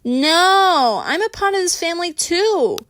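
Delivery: put-on high, loud drunken protest; tipsy yell. A narrator's reading. Tipsy Christina yelling at Craig, loud and indignant. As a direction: insist loudly, wounded. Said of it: "Christina yelled".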